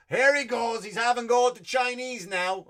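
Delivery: loud, high put-on voice of a scolding critic; mock heckler. Comic voicing a critic who accuses him, loud mock scolding. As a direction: play the finger-pointing critic who caught him out.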